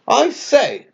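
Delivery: a high, curious pheasant. Acted. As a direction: peer down at the odd bird, pitched high. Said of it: "a pheasant asked".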